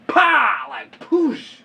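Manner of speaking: loud high "pow", then "poosh"; impact noises. Retold or imitated. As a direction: punch the crash sounds out. Imitates tire going over dog.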